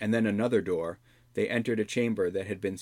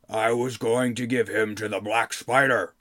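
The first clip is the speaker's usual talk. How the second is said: a hint of a gruff goblin king; lightly voiced defeated goblin king; 4 dB louder than usual.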